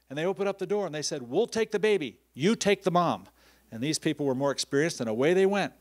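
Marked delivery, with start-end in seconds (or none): 1.2-3.3 urgent orders. Retold or imitated. Crew taking over urgently.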